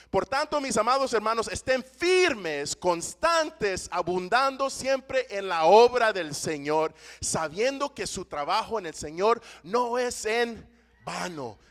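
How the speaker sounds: slow, loud solemn reading; proclaiming the Bible verse; scripture reading. Acted. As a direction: read the verse with weight. Reads scripture aloud.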